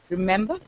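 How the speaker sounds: "Remember" as a soft r'MEMber; swallowed first syllable. Shown as a demonstration. Teacher checking learners recall, RE swallowed in Remember. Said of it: "Remember how to swallow it".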